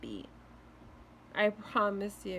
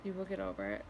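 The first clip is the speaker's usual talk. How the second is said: soft reassurance; 12 semitones lower, 6 dB quieter, faster than usual. Real, not acted.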